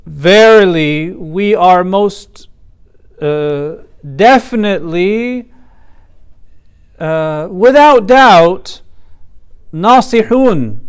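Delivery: heavily stressed, slow and artificially intense; stilted emphasis. Shown as a demonstration. Lecturer showing students the intensity of the Arabic, stilted emphasis. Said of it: "in a very stilted and artificial way".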